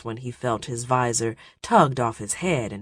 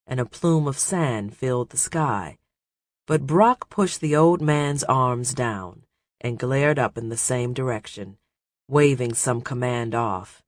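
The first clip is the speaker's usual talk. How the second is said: ordinary delivery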